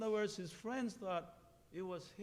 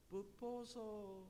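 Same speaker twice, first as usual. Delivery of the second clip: soft, drawn-out call; distant bird call; 3 semitones higher, 5 dB quieter, slower than usual. A narrator's reading. Owl's voice heard from far away, soft drawn-out call. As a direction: let the name float out long and softly.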